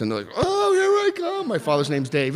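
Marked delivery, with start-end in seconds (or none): 0.4-1.5 high squeaky mock; mocking falsetto taunt. Family mocking him in high voice.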